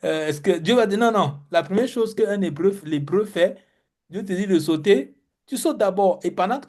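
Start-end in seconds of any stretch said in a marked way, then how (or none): none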